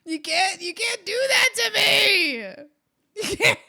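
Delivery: high, loud hammed-up cry; loud, high fake sobbing protest; mock anguished shout. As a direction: wail at chat as if betrayed. Mock hurt protest to chat.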